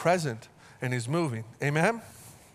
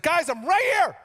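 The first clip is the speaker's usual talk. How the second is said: high, louder imitation of God calling out; God's voice; 12 semitones higher, 5 dB louder than usual. Preacher voicing God trying to get noticed, high and louder. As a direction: play God waving for attention. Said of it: "He's like".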